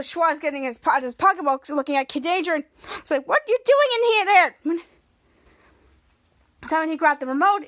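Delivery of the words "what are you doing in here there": high, loud exclaimed question; retold startled question. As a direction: imitate a character calling out in surprise.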